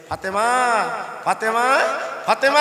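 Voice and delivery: name called with mounting pitch and volume; calling out. Acted. Usman calling Fatema at her door, rising calls.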